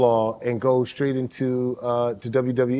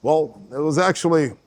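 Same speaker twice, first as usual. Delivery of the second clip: halting, measured presidential impersonation; Barack Obama. Acted. Correcting the host about a story, Obama impression. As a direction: pause and hedge like the mimicked president. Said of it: "But then you won't do your Barack".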